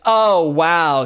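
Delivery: loud, leaned-on mock amazement; dripping sarcasm; sarcastic. Shown as a demonstration. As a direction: feign being impressed, heavy on the words. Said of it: "like, a sarcasm".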